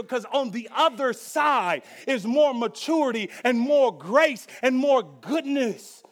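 loud, high pleading, slowing at the end; fervent preached prayer. Acted. Pastor modelling a bold prayer for the church, loud and fervent. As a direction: cry it out like a plea to God.

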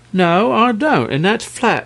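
high, firm dragon refusal; sharp retort. A narrator's reading. Dragon refusing the king's orders, sharp. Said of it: "returned the dragon sharply".

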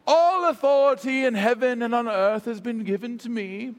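formal, theatrical British Shakespearean recitation; grand old British scripture voice; Shakespearean lilt. Acted. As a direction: intone it like a stage Shakespearean. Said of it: "kind of Shakespearean lilt".

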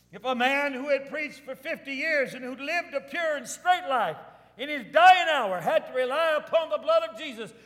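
high, loud preacher's appeal; urgent pulpit proclamation at full voice; full-force preaching. Real, not acted. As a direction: press the congregation with an impassioned challenge.

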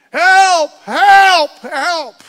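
high, loud screams of help; panicked man screaming for help. Retold or imitated. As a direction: yell for help from far off. DEA agent screaming from the field.

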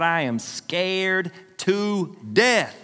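very slow, each word stretched; drawn-out emphasis. Retold or imitated. Retelling his fear, stretched for emphasis.

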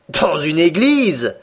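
can't believe his ears; the Canadian cries out at the church story; astonished exclamation. A narrator's reading. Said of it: "s'écria le Canadien".

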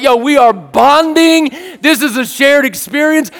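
high, emphatic exclaiming; triumphant hype, stretching the key words, with barks at the end; hyped up. Retold or imitated. Man thrilled to be bonding with his dog, hyped up. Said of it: "I'm hyped up with him".

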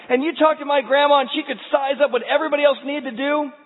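high, loud, driving sermon; fiery preaching. Acted. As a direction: build it up like a revival preacher. Preaches at full force.

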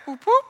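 short high imitated siren whoops; police siren whoop. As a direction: mimic a police siren with two quick whoops. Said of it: "the police sirens go off".

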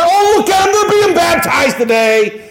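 high, shouted play-by-play of joy; exultant angels. Acted. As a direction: cry it out as heaven's thrilled onlookers. Acting angels watching a baptism.

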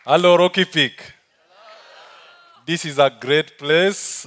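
usual speaking voice

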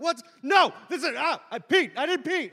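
loud, high, stumbling protest; flustered mock panic. Student caught patting his crotch, flustered loud denial. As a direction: blurt a frantic, hammed-up denial.

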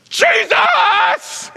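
a big high shout of recognition; high excited shout of Jesus; excited cry. Retold or imitated. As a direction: call out his name with joy. Peter spots Jesus, cries out.

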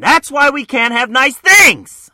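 hammed-up high, very loud shout; furious scolding. Acts Amanda furiously scolding Max.

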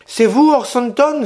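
petite voix aiguë, un peu effrayée, qui s'écrie; s'écrier avec une légère frayeur d'enfant; fillette effrayée. A narrator's reading. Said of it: "S'écria l'enfant un peu effrayé". (une fillette surprise par Orso s'écrie, légèrement effrayée)

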